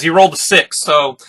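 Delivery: ordinary delivery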